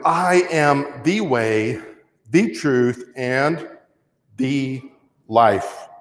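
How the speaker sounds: measured recital with weighty pauses; solemn scripture quote. Acted. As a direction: let each phrase land separately, slowly.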